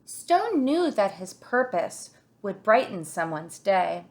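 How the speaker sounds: ordinary delivery, nothing marked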